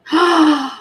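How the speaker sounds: loud, high gasp; sharp gasp. Real, not acted. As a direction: gasp in shock at the screen. Reacting to the show.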